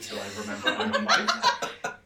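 loud, very high mock bewilderment; panicked self-doubt. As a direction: squeal it in hammed-up existential panic. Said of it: "starts to doubt himself".

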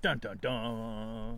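dramatic dun dun dun. Pretend. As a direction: hum it like a suspense sting. Dramatic suspense sting.

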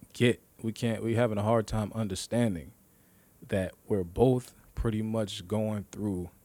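usual speaking voice

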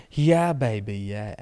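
lightly imitated swinging catchphrase; a hint of a film character's catchphrase voice; Austin Powers. Fan quoting a film after saying they love accents, playful imitation. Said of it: "watching Austin Powers".

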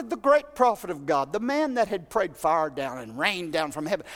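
normal talking voice

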